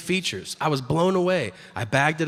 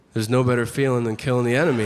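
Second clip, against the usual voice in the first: fast callous quip; 3 semitones lower, faster than usual. Retold or imitated. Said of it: "And I said".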